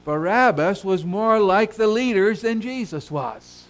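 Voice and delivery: loud, high, emphatic pulpit declaration; impassioned preacher; forceful preaching. Real, not acted. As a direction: drive the point home to the congregation. Preacher driving home his point.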